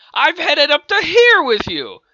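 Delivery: very high, loud exasperated outburst; madder yell. Acted. Roy fed up with Peter, furious yell. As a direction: blow up at him at full voice. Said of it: "Roy got madder".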